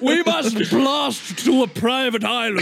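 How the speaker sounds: grand wizard urging on his horse; Gandalf. Acted. Plays Gandalf urging on Shadowfax.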